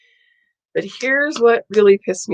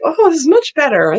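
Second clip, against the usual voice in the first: mock nurse; 9 semitones higher, 6 dB louder than usual. Nurse voiced in retold exchange.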